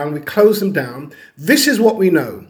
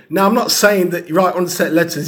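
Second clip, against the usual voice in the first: normal talking voice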